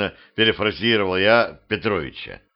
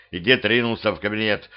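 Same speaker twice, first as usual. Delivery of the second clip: ordinary delivery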